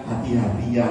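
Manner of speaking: low, soft-edged caution; calm, low-pitched tender reminder; gentle warning. Shown as a demonstration. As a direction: say it gently, as if holding the driver's arm. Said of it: "harusnya dia ngomongnya pelan-pelan".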